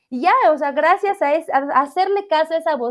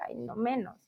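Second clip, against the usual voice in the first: much softer aside, mildly dismissive; a quiet, tired mock groan of refusal; muttered dismissal; 13 dB quieter than usual. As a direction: say it under your breath, half to yourself. Retold inner reaction to transfers.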